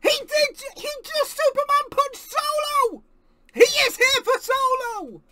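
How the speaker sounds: loud, high thrilled yelling; excited shout. Real, not acted. Fan celebrating the feud he wanted, thrilled shout. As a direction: shout it in triumph. Live reaction to punch.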